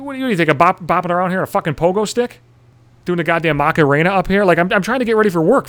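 high-pitched indignant rant; exasperated retort. Retold or imitated. Getting ready for work, ranting back at the neighbour, exasperated and high. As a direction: argue back, incredulous and worked up.

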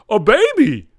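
high, loud bratty sing-song; mocking a self-satisfied parent; smug mock answer.

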